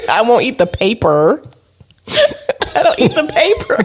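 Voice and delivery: giggling through the denial; laughing protest. Real, not acted. Wife laughs while denying husband's teasing about cheese paper. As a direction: laugh while insisting you don't.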